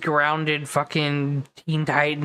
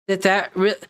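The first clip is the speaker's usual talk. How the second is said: usual speaking voice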